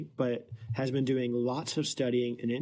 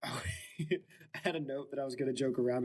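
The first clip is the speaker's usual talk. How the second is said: ordinary delivery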